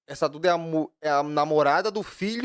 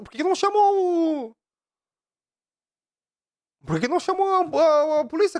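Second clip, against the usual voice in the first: agudo, em mock indignação crescente; high-pitched mock outrage at petty drama; indignação debochada; 10 semitones higher, 4 dB louder than usual. Ironia indignada com a fofoca. (indignação fingida, voz aguda)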